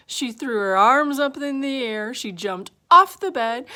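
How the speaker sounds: high, loud, excited telling; alarmed narration. A narrator's reading. Goldilocks seeing three bears and leaping up, alarmed narration.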